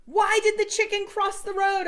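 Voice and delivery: high loud shout; yelled. A narrator's reading. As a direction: yell it at the charging chicken. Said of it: "I yelled".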